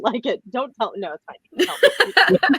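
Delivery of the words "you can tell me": higher, louder playful tease; teasing. Real, not acted. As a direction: coax them with a grin in your voice. Teasing reassurance after joke.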